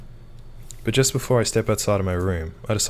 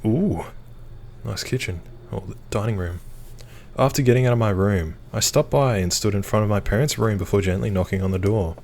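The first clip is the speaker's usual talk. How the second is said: usual speaking voice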